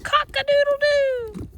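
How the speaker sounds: high crowing call; rooster crow. A narrator's reading. The flustered fowl crowing in a high voice. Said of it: "who crowed".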